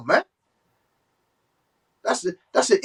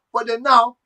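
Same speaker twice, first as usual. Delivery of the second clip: ordinary delivery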